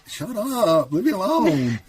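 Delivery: high, hammed-up whine of someone caught crying; mock tearful protest. Caught crying at the movie by a friend, mock tearful protest. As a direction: play up an embarrassed, weepy brush-off.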